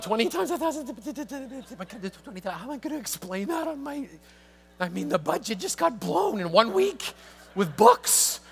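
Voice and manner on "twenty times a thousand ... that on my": panicked thought. Retold or imitated. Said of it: "'cause I'm thinking".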